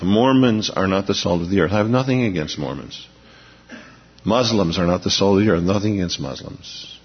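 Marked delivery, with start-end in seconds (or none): none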